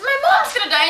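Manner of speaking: high and amused mock alarm; laughing quote. Retelling his reply to friends, high amused mock alarm. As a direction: chuckle through a fake worry. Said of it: "I said".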